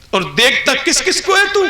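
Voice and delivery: high, loud accusing call; booming rebuke from the unseen; stern divine rebuke. Acted. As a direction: scold him from on high.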